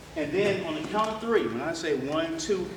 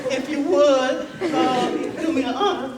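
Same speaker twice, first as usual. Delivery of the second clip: nervous, not smooth; 7 semitones higher, 3 dB louder, slower than usual. Retold or imitated. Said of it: "I wasn't that smooth".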